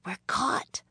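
put-on high, hushed girl; whispered. A narrator's reading. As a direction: whisper it to your brother in dismay. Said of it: "she whispered".

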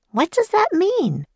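put-on high, eager little girl's voice; bright young girl. A narrator's reading. Annie introducing herself and Jack to famous inventors, eager girl voice. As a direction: chirp it like an excited young girl.

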